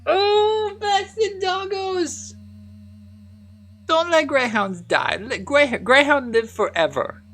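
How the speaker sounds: put-on very high, slow baby-talk gushing; dog-lover baby voice; squeaky cutesy voice. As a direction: coo over the greyhound like a child.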